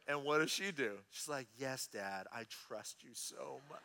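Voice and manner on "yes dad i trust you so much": low, very soft, mock-sweet voice; hushed, put-on devotion; mock trusting child. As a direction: pretend to trust completely, very quietly. Mock trusting reply from daughter.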